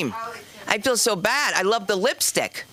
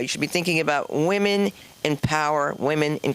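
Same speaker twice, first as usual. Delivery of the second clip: ordinary delivery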